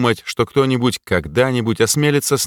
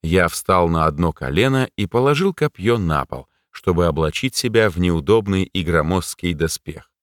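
ordinary delivery, nothing marked

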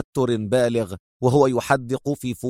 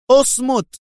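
much higher, louder final اصمت; topping shout of اصمت; 12 semitones higher, 4 dB louder than usual. A narrator's reading. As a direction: yell اصمت at full pitch to end it. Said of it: "صاحت به".

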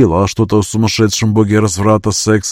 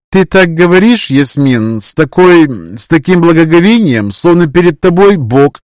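put-on high, loud scoffing girl voice; mocking young girl; 7 semitones higher, 5 dB louder than usual. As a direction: scoff at her like a cheeky girl. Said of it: "хмыкнула от двери Яна".

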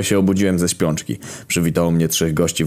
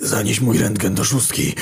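strained, raised voice with broken pauses; dying gasps; 6 semitones higher than usual. A narrator's reading. Wounded Kamil on his friend's knees, dying gasps. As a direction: gasp out a last request.

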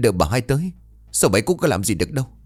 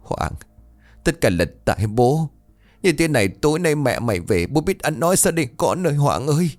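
choked, rising voice of grief; 6 semitones higher than usual. Acted. Father grieving over his son, choked up. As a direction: hold back the tears as you speak. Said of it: "chỉ nghẹn ngào nói".

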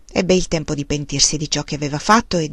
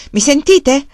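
higher, louder call; 6 semitones higher, 5 dB louder, slower than usual. A narrator's reading. Woman calling up the stovepipe to the stranger, calling out. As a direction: call to someone out of sight. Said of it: "chiamò".

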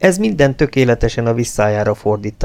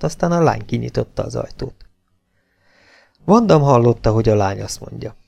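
usual speaking voice

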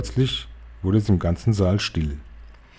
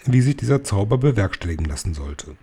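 ordinary delivery

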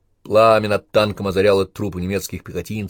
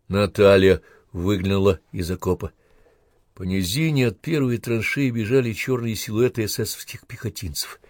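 plain reading voice

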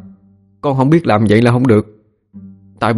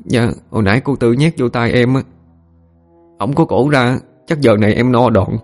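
put-on higher boy's voice, understated tearfulness; tearful; 5 semitones higher than usual. A narrator's reading. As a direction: answer as a beaten boy, mildly weepy. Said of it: "Nó vừa nói vừa khóc". (giọng thằng nhỏ mếu máo, cao hơn)

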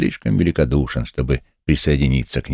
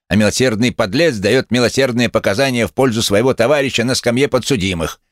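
raised pitch, biting retort; spiteful retort; 6 semitones higher than usual. A narrator's reading. Lame man sneers at false charity, spiteful retort. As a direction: snap back with spite. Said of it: "злобно огрызнулся Хромой".

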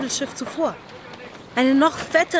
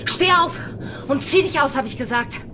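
angry repeated order; 4 semitones higher than usual. Acted. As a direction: snap the order again with a weapon raised. (wütender Befehl mit Waffe)

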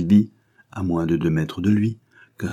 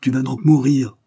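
louder and higher, a death sentence; threatening thug; 7 semitones higher, 6 dB louder than usual. Acted. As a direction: pronounce his doom with force. Thug condemns the prisoner.

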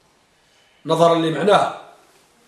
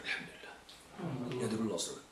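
muttered aside; 11 semitones lower, 25 dB quieter than usual. Real, not acted.

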